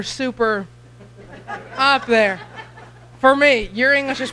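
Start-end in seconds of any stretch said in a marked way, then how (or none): 1.8-4.3 laughing through the words; giggling at her own weak English. Real, not acted. Missionary laughing at herself to the church, laughing.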